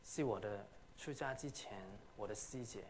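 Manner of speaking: ordinary delivery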